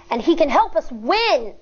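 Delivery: ordinary talk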